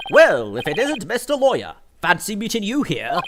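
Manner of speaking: high, loud, hearty greeting; hammed-up detective. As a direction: go all in as a showy detective. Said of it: "going all in with the little Korean voice".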